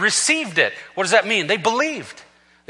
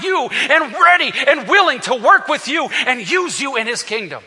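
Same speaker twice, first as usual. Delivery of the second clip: preaching build; 7 semitones higher, 4 dB louder than usual. Acted. Preacher lifting the church toward a shouted climax. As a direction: build the titles of Jesus to a peak.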